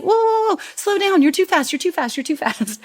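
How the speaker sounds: high, pleading mock panic; mock flustered. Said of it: "And it's like".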